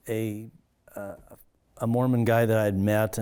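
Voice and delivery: normal talking voice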